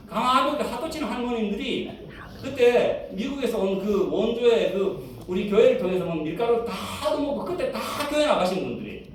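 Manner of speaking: ordinary talk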